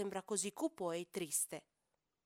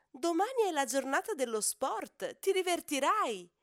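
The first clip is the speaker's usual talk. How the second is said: excited mother; 7 semitones higher, 7 dB louder than usual. Acted. Mother cheering her cub on.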